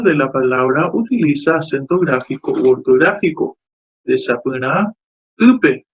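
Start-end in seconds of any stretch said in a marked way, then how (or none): 5.4-5.8 ýpe said higher and louder, punching the first syllable ý; stressed example word. Shown as a demonstration. Teacher presenting ýpe to students, stress punched on the ý. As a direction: announce ýpe as a model word, hitting the ý hard. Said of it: "ogueraha myenduhe hai".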